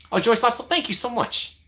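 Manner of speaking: light up as you thank them; excited thanks. Real, not acted. Streamer thanking a viewer for the gift, excited and high.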